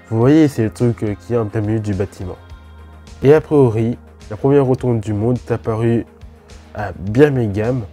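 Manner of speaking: ordinary talk, nothing marked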